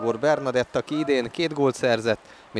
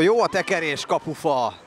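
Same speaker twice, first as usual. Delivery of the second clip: excited commentary; 6 semitones higher than usual. Real, not acted. Free kick hits post.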